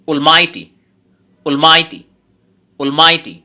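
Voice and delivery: almighty as one word, MIGH punched loud; stress on middle syllable. Shown as a demonstration. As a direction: repeat almighty as a drill, hitting MIGH hard. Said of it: "জোর দিতে হবে". (almighty একটানে, মাঝের সিলেবলে জোরে)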